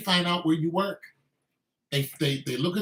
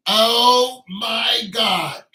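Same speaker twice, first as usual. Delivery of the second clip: exasperated disbelief; 5 semitones higher, 7 dB louder, much slower than usual. Real, not acted. Reacting to wife's TikTok post.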